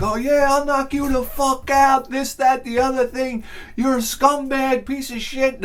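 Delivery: high-pitched argumentative ranting impression; mock quarrel. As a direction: imitate men trading threats, pitched high. Acted beach shouting match between men.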